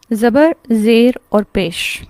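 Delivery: slow and deliberate zabar, zer aur pesh; name each haraka slowly, one by one; teacher drilling each vowel mark; slow, spelled out names. Shown as a demonstration. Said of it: "ہم میں سے الحمدللہ ہر ایک کو معلوم ہے".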